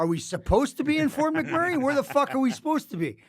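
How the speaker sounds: high, loud panicked yelling; exasperated outburst. Retold or imitated. MC who wrote a speech for the wrong city, yelling. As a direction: explode in disbelief at your friend.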